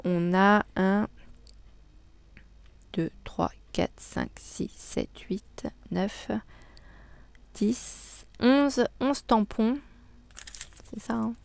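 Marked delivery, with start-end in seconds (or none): none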